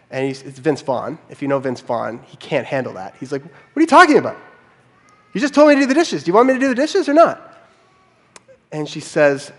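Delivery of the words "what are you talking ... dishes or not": much higher, louder, baffled protest; imitating a frustrated husband's rant; exasperated outburst. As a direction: throw up your hands at her, ham it up. Vince Vaughn's exasperated outburst.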